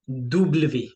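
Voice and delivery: डब्ल्यू said as double vé; French W. Shown as a demonstration. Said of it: "टू वीज". (French में डब्ल्यू, दो वी जैसा)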